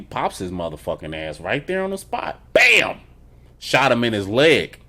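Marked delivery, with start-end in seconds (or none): 2.5-3.0 gunshot bam. Retold or imitated. Acting out the gunshot.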